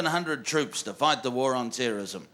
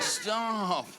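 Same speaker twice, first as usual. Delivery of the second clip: mock pleading; 7 semitones higher, much slower than usual. Mock plea to stop teasing.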